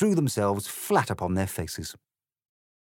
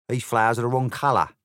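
brisk, louder card-soldier voice; a flustered card rushing to explain; five of spades; 3 semitones higher, 6 dB louder, faster than usual. A narrator's reading. Five of spades explains the roses to Alice, quick and loud. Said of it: "said the five of spades".

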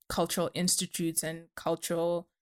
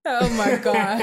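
laughing; 3 semitones higher, 7 dB louder, slower than usual. Real, not acted. Asked to recite a poem.

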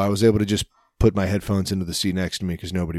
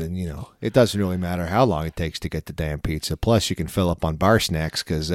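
mock Chicago accent. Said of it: "Chicago is just so goddamn fun to do".

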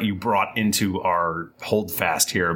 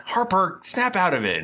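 sharp; 12 semitones higher than usual. Acted. Slapping friend back to senses.

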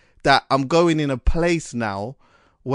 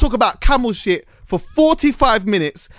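emphatic; 7 semitones higher, 5 dB louder than usual. Real, not acted. Passionate declaration.

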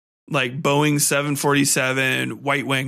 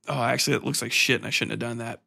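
mock regret; 5 semitones lower, 5 dB quieter, faster than usual. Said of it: "they're like".

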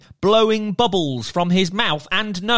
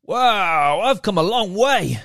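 merman voice; 4 semitones higher than usual. Acted.

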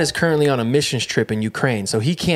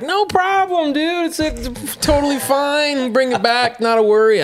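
breezy reassurance; 13 semitones higher than usual. Retold or imitated. Salesman telling his buddy to return the car, high and breezy. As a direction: play the laid-back pal, voice way up.

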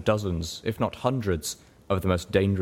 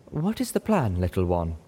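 zebra mare voice. Acted. Zenith voiced question.

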